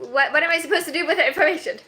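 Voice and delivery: fast, loud flustered outburst; flustered exclaim. Real, not acted. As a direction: blurt it out in a fluster. Reacting to a chat comment.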